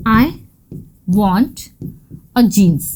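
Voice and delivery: "I... want... a jeans", long pauses between the chunks; word-by-word demonstration. Shown as a demonstration. Teacher showing learners a wrong sentence, word by word. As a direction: lay out "I want a jeans" piece by piece. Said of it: "तो हम ऐसे तो नहीं बोल सकते".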